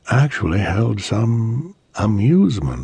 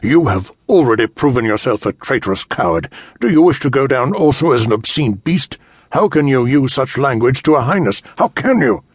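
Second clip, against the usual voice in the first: outraged knight, louder, rising to a cry; indignant fury at an insult; furious; 7 semitones higher, 5 dB louder than usual. Acted. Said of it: "in a voice full of fury".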